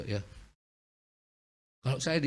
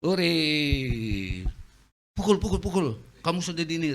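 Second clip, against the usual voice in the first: high, loud cheering taunt; gleeful devil; 4 semitones higher, 4 dB louder, slower than usual. Retold or imitated. Devil cheering the drivers' fight, loud gleeful taunting. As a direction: egg on the fight like a delighted devil. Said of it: "dia senang".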